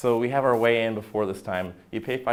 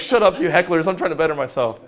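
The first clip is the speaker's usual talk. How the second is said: louder, higher mock retort; mock snapping back; 8 semitones higher, 8 dB louder than usual. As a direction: snap back at the hecklers, half joking. Snapping at online hecklers.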